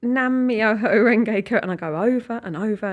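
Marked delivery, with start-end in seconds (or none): 0.0-1.6 each syllable drawn out slowly; slow and clear. Shown as a demonstration. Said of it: "say it slowly and clearly".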